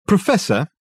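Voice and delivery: loud, high call. A narrator's reading. Dirk calling Reg out in the kitchen, called out. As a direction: call through to the next room. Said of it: "called out Dirk".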